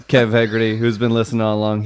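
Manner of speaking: ordinary talk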